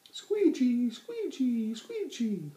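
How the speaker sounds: very high, loud squeaking; high creaky squeegee; windmill squeak. A narrator's reading. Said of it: "said the windmill".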